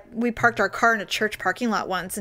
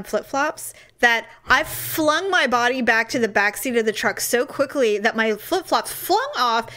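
ordinary talk, nothing marked

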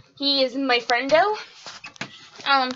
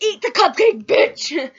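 loud, higher, defiant shout; mock movie yell; 5 semitones higher, 7 dB louder, slower than usual. Retelling the Happy Death Day ending to viewers, imitating a loud defiant yell. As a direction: yell it like the final girl fighting back. Quoting a horror film character.

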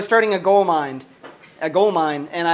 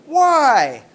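put-on kid's whine; 6 semitones higher, 5 dB louder than usual. Daughter voiced, pestering.

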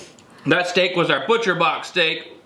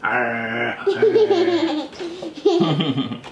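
long laugh; 10 semitones higher than usual. Real, not acted. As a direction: burst out laughing. Laughs after Gollum bit.

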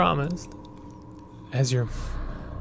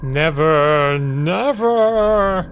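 villainous refusal; 5 dB louder, much slower than usual. Acted. Monster in her head refusing Fran, rising and louder. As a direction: slam the door on her hope, rising.

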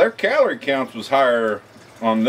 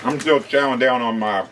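snacking between words; chewing while talking; 4 dB quieter than usual. Real, not acted. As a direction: mouth partly full. Seen 1: Man holds crouton bag, eats before and after, hand near mouth.

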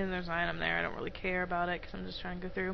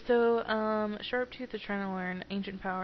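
normal talking voice

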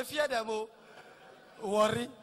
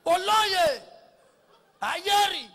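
loud high praise-calls; hailing titles; 7 semitones higher, 9 dB louder, much slower than usual. Retold or imitated. Praise-singers hailing a chief, loud calls. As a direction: hail him like a chief. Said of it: "what they hail".

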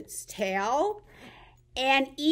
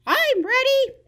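puppet voice; 12 semitones higher, 6 dB louder than usual. Acted. Frog puppet answers.

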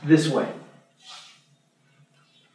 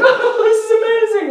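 much higher, gushing delight; squealing mock delight; 4 dB louder than usual. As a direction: gush it like a thrilled ad character. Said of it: "And she's like".

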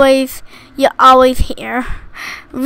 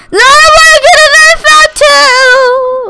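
dramatic wail; 10 semitones higher, 4 dB louder than usual. Pretend. Dramatic wail after falling.

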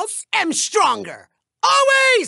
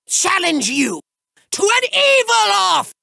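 ordinary talk

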